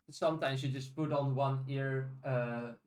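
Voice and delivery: normal talking voice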